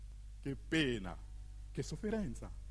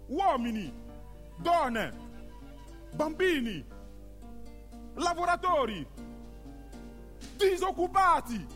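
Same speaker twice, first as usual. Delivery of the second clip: each group called out higher, building; rising roll call; 8 semitones higher than usual. Acted. Narrator listing the townspeople against the ship, rising roll call. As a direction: call them out one by one, climbing in pitch.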